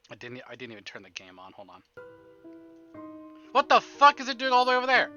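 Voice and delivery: ordinary talk, nothing marked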